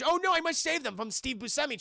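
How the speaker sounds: high-pitched hammed-up heroic worry; exaggerated high cry of concern; mock alarm. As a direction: play a naive hero in a panic. Acts out naive hero's alarm.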